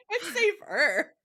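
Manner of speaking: words breaking into a laugh. Real, not acted. Laughing along as her cohost corrects her. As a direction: giggle through the correction.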